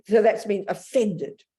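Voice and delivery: ordinary talk, nothing marked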